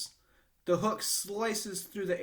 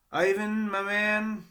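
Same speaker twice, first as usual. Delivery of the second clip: put-on drunk mumble; 7 semitones higher, 7 dB louder, slower than usual. A narrator's reading. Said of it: "he mumbles".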